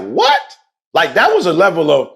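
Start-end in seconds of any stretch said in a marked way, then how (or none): 0.0-0.4 high startled what; retold disbelief, pitched way up; shocked what. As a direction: squeak it out in disbelief. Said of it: "I'm like".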